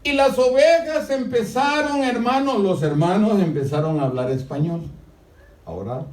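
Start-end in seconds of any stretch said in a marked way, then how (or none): 0.0-4.8 much higher, louder preaching cry; fervent sermon climax, high and loud; full-force preaching. Acted. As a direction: lift it into a fervent pulpit cry.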